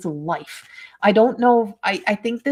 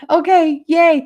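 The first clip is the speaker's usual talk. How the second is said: excited; 8 semitones higher, 9 dB louder, slower than usual. Real, not acted. Mother recognises the drawing.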